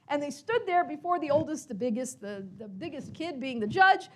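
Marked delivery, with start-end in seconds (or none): none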